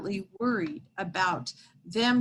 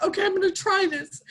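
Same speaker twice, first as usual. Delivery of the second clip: higher, fast, urgent; frantic resolve; 9 semitones higher, 4 dB louder, faster than usual. Retold or imitated. Mother trying to save the woodpecker, higher and frantic. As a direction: rush it out like you're desperate to try anything. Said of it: "I'm like".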